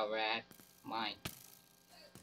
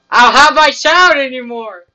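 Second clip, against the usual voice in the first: much higher, loud despairing cry; hammed-up anguished wail; 10 semitones higher, 16 dB louder than usual. Gamer who just died and lost his child, mock anguished wail. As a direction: wail the loss dramatically.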